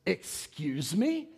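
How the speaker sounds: imitated incredulous comeback, rising sharply at the end; mock indignation. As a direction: stretch it out as if deeply offended. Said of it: "the tone that I was taking".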